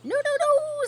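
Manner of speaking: very high, loud honking no; alarmed goose. A narrator's reading. Goose stopping Wilbur, very high and loud. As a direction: cry out in alarm. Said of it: "said the goose".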